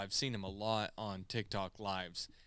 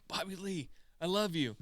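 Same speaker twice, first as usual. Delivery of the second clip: eager fan call; 7 semitones higher than usual. Retold or imitated. Calling out to streamer again.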